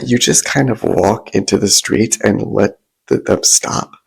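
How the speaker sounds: usual speaking voice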